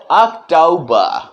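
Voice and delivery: "अक्टूबर" slowly as ak-tau-ba, short u, no r; British October. Shown as a demonstration. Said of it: "दीर्घ उकार की मात्रा का प्रयोग हम नहीं करेंगे".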